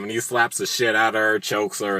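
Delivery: ordinary delivery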